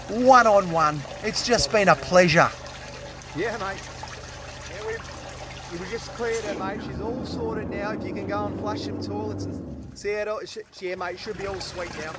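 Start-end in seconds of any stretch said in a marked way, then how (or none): 3.3-12.1 much softer, almost whispered report; quiet aside. Real, not acted. Plumber quietly telling the homeowner the drain is cleared, much softer. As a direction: drop the voice for an off-camera chat. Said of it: "he's seen me just go absolutely berserk".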